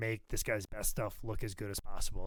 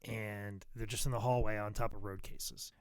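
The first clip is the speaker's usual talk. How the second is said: normal talking voice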